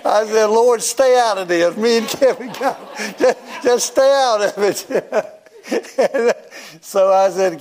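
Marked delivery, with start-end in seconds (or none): 0.5-5.3 high-pitched mock plea to the Lord; playful exasperation, voice pitched up; comic protest. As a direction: protest upward, half laughing.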